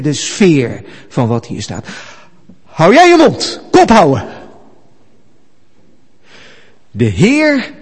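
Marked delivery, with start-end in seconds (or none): 2.6-7.7 high, loud shouted command; angry shout. Acted. Angel shouting at Satan the accuser, a furious shout. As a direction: shout him down hard. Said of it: "Hij schreeuwt naar hem".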